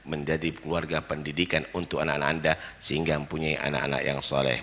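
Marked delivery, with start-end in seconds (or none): none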